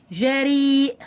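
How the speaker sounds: held, steady final vowel; drawn-out model word. Shown as a demonstration. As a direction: present the word slowly to the class. Teacher models example word. (نطق ممدود للكلمة)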